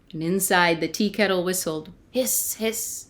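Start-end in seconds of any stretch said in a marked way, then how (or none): 2.1-3.1 soft hiss. Acted. Quiet kettle hiss.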